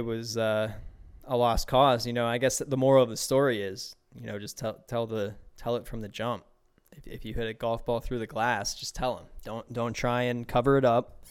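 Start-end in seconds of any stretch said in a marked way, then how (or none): none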